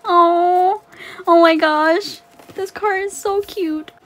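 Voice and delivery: high, thrilled cooing; gushing delight. Real, not acted. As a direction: fawn over something adorable. Gushing over the revealed card.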